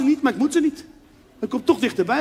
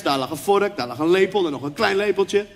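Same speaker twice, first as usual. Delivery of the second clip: ordinary delivery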